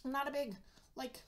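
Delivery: biting a crunchy snack; talking while eating a snack. Real, not acted. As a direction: mouth partly full. Seen 1: Holds a cheezie for 0-6 s, then bites it from 6.9 s.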